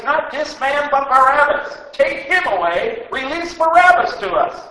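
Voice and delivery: high, loud mob yell; crowd shouting. Acted. Crowd yelling at the governor for Barabbas, loud shout. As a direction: roar it back as one angry crowd. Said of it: "they all shouted together with one voice".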